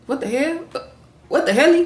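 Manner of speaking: mock-baffled reaction, voice lifting at the end; retold disbelief. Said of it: "And Liv is like".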